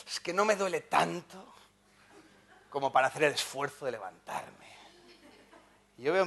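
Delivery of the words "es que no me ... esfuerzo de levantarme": lazy dog. Retold or imitated. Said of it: "Y dice".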